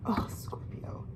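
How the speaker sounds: soft breathy whisper; hushed tender whisper. Real, not acted. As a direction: whisper it close and intimate. Tender aside to the Scorpio.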